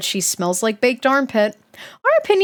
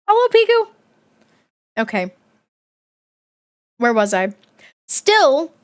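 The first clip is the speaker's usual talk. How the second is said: ordinary delivery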